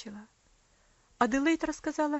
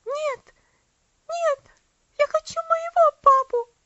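put-on much higher, loud, desperate little girl; frightened child; 13 dB louder than usual. A narrator's reading. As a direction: push him away in fever. Delirious child refuses father.

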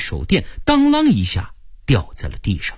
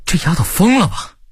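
higher-pitched, stammered disbelief; startled inner thought; 9 semitones higher than usual. A narrator's reading. Narrator startled at her daring to talk back, shocked. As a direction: think it in shock, voice jumping up. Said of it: "我吓了一跳， 心说".

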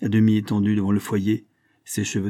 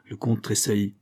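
usual speaking voice

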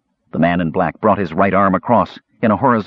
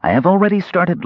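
smug mage; 10 semitones higher than usual. A narrator's reading. Mage answers smugly.

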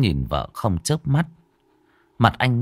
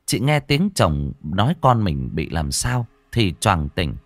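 usual speaking voice

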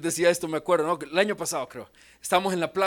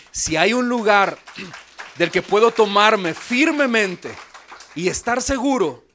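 louder, rising pulpit climax; forceful preaching; 4 semitones higher, 8 dB louder than usual. Acted. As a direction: lift the congregation to certainty. (clímax de predicación)

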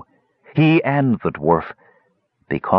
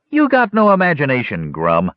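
sly goblin voice; 5 semitones higher than usual. Acted. Goblin teases the other.

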